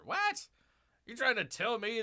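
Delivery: high incredulous yelp; squeaky what; mock disbelief. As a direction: leap up in fake shock.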